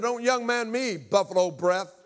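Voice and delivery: put-on high, loud kid talking back; retold defiant shout. Boy talks back to stepfather, acted defiant shout. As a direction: throw it back at him, hammed-up.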